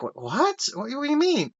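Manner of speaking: mock disbelief, jumping up high; retold shock. Retold friend hearing about haunting aunt and uncle, mock shock. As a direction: stammer in high imitated surprise.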